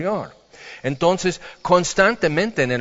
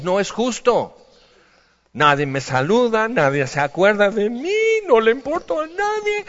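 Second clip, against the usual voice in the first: mock complaint; 6 semitones higher than usual. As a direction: pitch jumps much higher into a whiny wail. Voices a self-pitying complaint.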